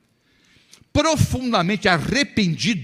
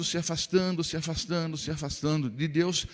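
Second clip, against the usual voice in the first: each se afastando softer and softer; fading repetition; 5 dB quieter than usual. Acted. Preacher describing a soul drifting from God, fading softer. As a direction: let the voice drift away with each repeat. Drifting away, growing fainter.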